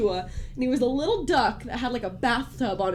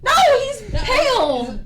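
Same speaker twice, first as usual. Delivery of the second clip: high, loud, slightly slow; silly high voice; 12 semitones higher, 6 dB louder than usual. Acted. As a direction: squeak it out playfully.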